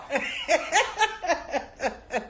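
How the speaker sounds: high-pitched laughing fit; real high giggle. Real, not acted. Teller laughing again.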